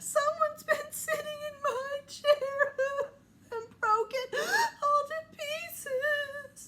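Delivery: high, loud tearful little bear voice; crying baby bear. Acted. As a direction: whimper it as a heartbroken cub. Baby Bear cries over chair.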